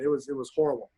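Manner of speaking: ordinary delivery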